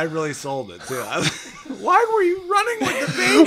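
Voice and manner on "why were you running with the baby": high, loud laughing mock scream; hammed-up horrified wife; panicked shriek. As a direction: shriek like a terrified mother. Wife's panicked question.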